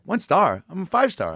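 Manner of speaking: high, indignant mock Larry voice; squeaky outraged Larry David; Larry David impression. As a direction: play up the offended Larry. Retelling Larry David's reaction.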